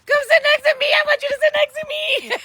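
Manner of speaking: very high, loud, hammed-up urging; eager high shout. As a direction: beg her to sit beside you. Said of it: "I was like".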